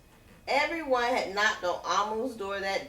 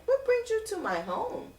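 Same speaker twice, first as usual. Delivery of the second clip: curious question; 13 semitones higher than usual. Acted. Amu greets the hot dog vendor.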